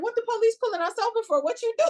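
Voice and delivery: fast, high, loud panicked questions; alarmed outburst. Retold or imitated. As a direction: replay the sudden panic at the lights. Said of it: "and I'm like".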